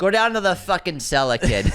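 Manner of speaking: put-on loud, high Boston accent. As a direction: bark it like a Boston guy. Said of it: "when you say it in a Boston accent".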